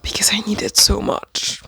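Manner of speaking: whispered plea; longing whisper. Real, not acted. To viewers, pining for the missing book, whispering. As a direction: breathe it like a secret wish.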